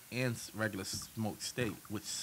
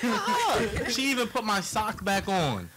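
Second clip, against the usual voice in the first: laughing; 11 semitones higher, 6 dB louder, slower than usual. Retold or imitated. Laughing retelling of a massage.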